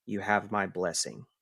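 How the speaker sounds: soft, low and simple; lightly acted, hushed benediction; gentle blessing. Spirit gives the player a blessing, soft and gentle.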